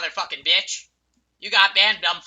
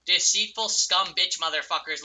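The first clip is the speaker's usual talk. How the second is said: ordinary talk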